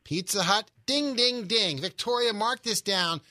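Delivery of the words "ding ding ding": game show ding ding. Pretend. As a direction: sing out a winning bell. Host signals a correct answer.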